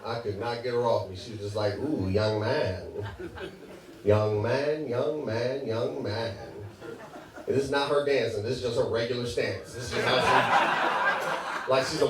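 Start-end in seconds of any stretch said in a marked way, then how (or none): 1.8-6.4 low, slow, soft cooing; low, lingering come-on. Retold or imitated. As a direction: draw out each young man like an admiring older lady. Clingy older woman cooing at him.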